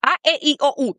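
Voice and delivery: "A, E, I, O, U" as five pure Spanish vowels; short, clean Spanish "A, E, I, O, U". Shown as a demonstration. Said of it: "We have five".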